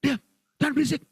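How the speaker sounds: high-pitched acted shushing. Disciples hushing the shouting woman, acted shushing. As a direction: hush a noisy woman urgently. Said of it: "Muridnya bilang, "Shh, diam".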